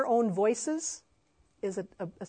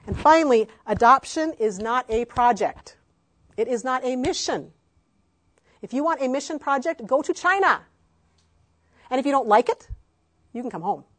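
louder, emphatic preaching point; 4 semitones higher, 9 dB louder than usual. Real, not acted. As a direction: drive it home, firm and loud. Sermon climax, emphatic.

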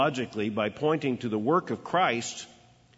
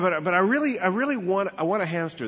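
put-on high, pleading little-boy voice, higher in pitch; 7 semitones higher, 3 dB louder than usual. Boy begging his parents for a hamster, high and pleading. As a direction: beg like an eight-year-old who wants it badly.

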